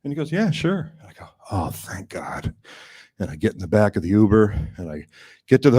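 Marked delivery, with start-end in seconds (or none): 0.3-0.9 higher, breezy yes; casual high-pitched agreement; driver's easy reply. Retold or imitated. 1.5-2.5 whispered slow relief; hushed thank-God relief; relieved whisper. Retold or imitated. Relief on being let off.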